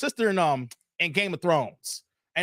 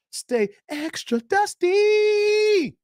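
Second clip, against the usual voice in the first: drawn-out taunt; 12 semitones higher, much slower than usual. Acted. To the haters: a slow, high, teasing taunt. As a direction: stretch it out high and slow, mocking.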